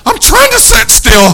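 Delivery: very high, loud comic squeal; exaggerated straining cry; strained mock yell. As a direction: blurt it out in a hammed-up high shout. Mimics himself or girls barely holding still.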